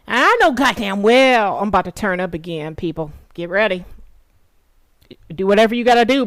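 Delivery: ordinary talk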